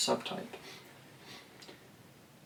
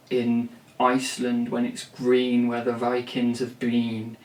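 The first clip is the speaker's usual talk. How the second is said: slow, louder, grandly absurd lyric recital; mock arty lyric; 5 dB louder, slower than usual. Songwriter parodying a weird arty lyric, slow and grand. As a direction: drag out a pretentious poetic line. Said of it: "unnecessarily weird".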